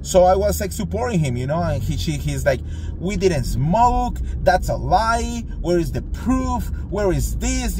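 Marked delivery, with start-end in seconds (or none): 3.0-7.8 high, slow, outraged protest; indignant denial. Retold or imitated. Friend protesting to hotel clerk, high indignant denial. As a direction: insist he never smoked, voice pitched up high.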